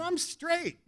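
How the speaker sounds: high, soft disclaimer; sheepish high-pitched denial; awkward protest. Retold or imitated. First protest of misunderstood offer.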